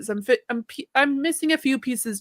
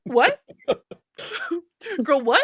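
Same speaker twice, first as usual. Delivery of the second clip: high, louder, laughing "what"; laughing in disbelief, high and louder; stunned disbelief; 5 semitones higher, 4 dB louder than usual. Retold or imitated. To her friend who quit too early, laughing in disbelief. Learning friend ended the day.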